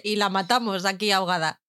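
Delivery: usual speaking voice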